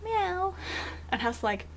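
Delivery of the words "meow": high imitated cat meow. Hearing the cat from the shower, a high mock meow. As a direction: mimic a faint cat meow from the next room. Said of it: "I thought I heard the cat go".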